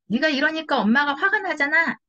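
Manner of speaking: blaming reproach. Shown as a demonstration. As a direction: scold the child the wrong way. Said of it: "이거는 안 돼요". (아이를 탓하며 높게 나무라는 말투)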